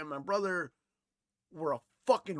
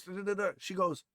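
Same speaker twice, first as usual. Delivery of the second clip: usual speaking voice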